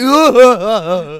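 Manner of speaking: loud, high fake boo-hoo; mock sobbing. Man crying as he runs away, loud mock sobbing. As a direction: imitate a big wailing sob. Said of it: "He's crying".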